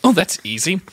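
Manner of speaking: ordinary talk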